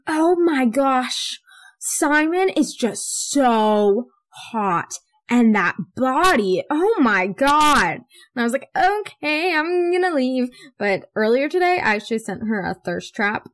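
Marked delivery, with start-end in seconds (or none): 0.1-8.0 slow, louder, higher swooning; hammed-up, drawn-out fangirl raving; gushing mom impression. Mom gushing over Simon to daughter, swooning. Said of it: "She was like". 8.8-10.5 high, drawn-out uneasy okay; retold awkward exit. Daughter escaping mom's gushing, awkward. Backing away from gushing mom.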